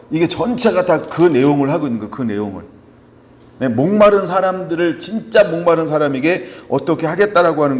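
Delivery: loud, driving sermon delivery; preaching at full force. Acted. Pastor preaching Isaiah 55 to the congregation, loud and driving. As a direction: press each point on the congregation.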